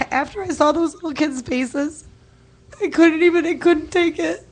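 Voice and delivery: real crying, struggling to speak; tearful. Real, not acted. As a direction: voice breaking high and tight with tears. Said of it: "I'm sorry if I get emotional".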